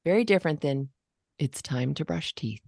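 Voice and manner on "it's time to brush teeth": low, softer, few calm words; lower calm version. Shown as a demonstration. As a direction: say it down low, short and steady. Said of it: "Just that lower register".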